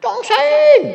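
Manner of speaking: high, loud yell of a name; a sudden piercing call; shouted name. Retold or imitated. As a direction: bellow the name across the house. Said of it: "teriak lagi".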